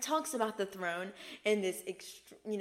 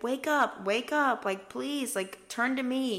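higher, louder wake-up call; raised, imploring voice; urgent pleading; 6 semitones higher, 5 dB louder than usual. Retold or imitated. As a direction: urge them insistently, almost begging. Voices Jesus urging people awake.

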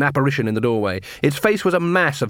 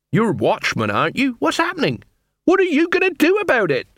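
high-pitched challenge climbing higher; demanding beggar queen; 14 semitones higher than usual. Acted. Beggar queen demands action from the watch, high and insistent. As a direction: press them, ending very high.

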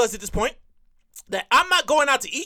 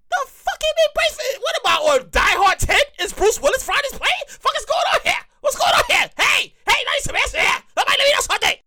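panicked yelling; 9 semitones higher than usual. Retold or imitated. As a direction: yell it like a terrified passenger. Panicking passenger yelling on plane.

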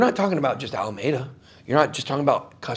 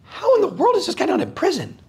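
incredulous. Retold or imitated. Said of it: "you're like".

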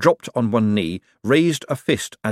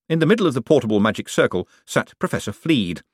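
ordinary delivery, nothing marked

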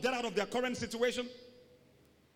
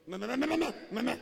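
loud, higher-pitched mimicked goat bleat; 4 semitones higher, 5 dB louder, faster than usual. Preacher mimics someone turned into a goat, bleating mockingly. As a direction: bleat like a goat in quick bursts. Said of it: "Look at him like a goat".

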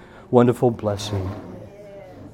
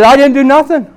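high-pitched defensive cry; retold indignant denial; loud protest; 12 semitones higher, 7 dB louder than usual. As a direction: protest innocence in a raised voice. Preacher's acted protest of innocence.

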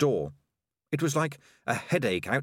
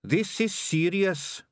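high, slow, insistent rat; Sardines the rat; 9 semitones higher, slower than usual. A narrator's reading. Rat warning his boss of danger, slow and insistent. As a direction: stress the danger slowly, high-pitched.

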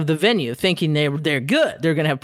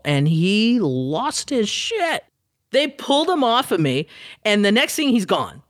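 ordinary talk, nothing marked

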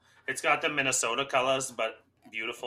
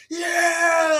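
much higher, louder whooping yell; retold goal cheer; 12 dB louder than usual. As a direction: whoop like the team just scored. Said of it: "celebrating every goal".

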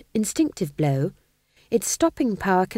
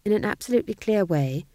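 plain reading voice

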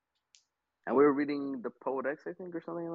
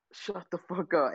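higher, drawn-out imitation of a botched whisper; failed whisper; 6 semitones higher, slower than usual. Student retelling a classroom outburst, failed whisper. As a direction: stretch it slowly, pitched up, meant as a whisper. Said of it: "I tried to say it whispering".

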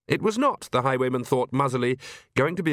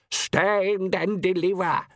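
swaggering gentleman highwayman; 7 semitones higher, much slower than usual. Acted. Highwayman's call.

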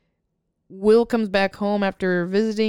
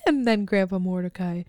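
ordinary talk

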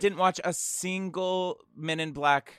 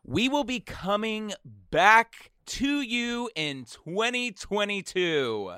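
announcer voice; 5 semitones higher, much slower than usual. Acted. Announcing next season.

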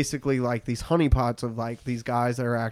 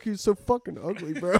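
laughing; 8 semitones higher than usual. Retold or imitated. Laughing while quoting the video.

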